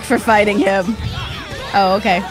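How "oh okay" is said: usual speaking voice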